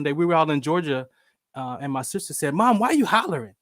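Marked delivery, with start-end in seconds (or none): 2.5-3.5 higher-pitched mock complaint; imitated exasperated question; exasperated protest. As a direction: act out a daughter objecting to mom. Sister protests mom's hollering.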